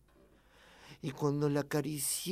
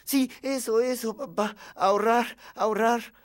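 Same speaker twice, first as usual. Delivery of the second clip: put-on high, loud child chiming in; over-eager kid repeating it; eager agreement; 7 semitones higher, 8 dB louder than usual. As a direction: agree hurriedly like a little boy. Child eagerly agrees to fool father.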